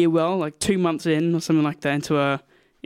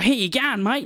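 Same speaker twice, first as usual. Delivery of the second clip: higher, emphatic Australian greeting; Australian accent; 6 semitones higher, slower than usual. Shown as a demonstration. Comparing greetings by country, emphatic Australian accent. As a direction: punch "mate" in an Australian accent. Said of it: "But in Australia, it's like".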